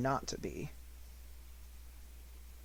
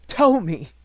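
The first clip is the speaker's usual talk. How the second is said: urgent demand; 5 semitones higher, 10 dB louder than usual. Acted. Desperate demand for the name.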